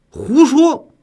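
angry shout. Acted. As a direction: snap it out furiously. Official's outburst at prisoner.

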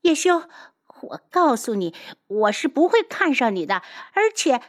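high-pitched, emphatic declaration; worked-up indignation; agitated. Acted. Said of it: "激动地指着他".

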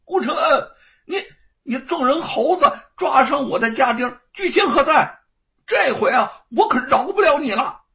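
high, loud furious tirade; a furious young master cursing at his enemy; loud scolding. A narrator's reading. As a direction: yell accusingly, pointing. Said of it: "大声地骂道".

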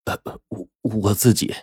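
hesitant and hushed, stumbling into the answer; awkward, uneasy. A narrator's reading. Young man admits who is moving in, awkward and hesitant. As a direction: falter softly with embarrassment. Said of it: "就不自然了".

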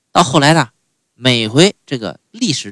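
usual speaking voice

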